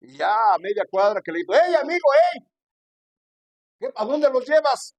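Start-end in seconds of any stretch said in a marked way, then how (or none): 1.5-5.0 high, loud call down the street; shouting after someone. Retold or imitated. Preacher shouts after the neighbour carrying off his blocks, loud high call. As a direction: holler to stop him half a block away.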